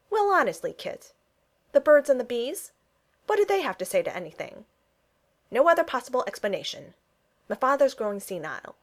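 indignant, mildly exasperated venting; outraged. A narrator's reading. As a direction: vent with baffled indignation. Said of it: "Freddie's outraged feelings".

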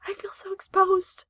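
whispered, high whimper. Acted. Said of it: "Nakatsu whimpered".

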